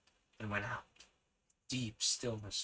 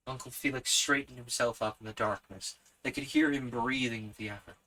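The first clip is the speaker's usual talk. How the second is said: ordinary delivery, nothing marked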